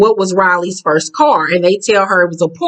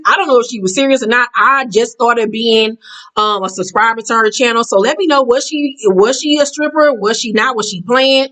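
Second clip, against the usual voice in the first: ordinary delivery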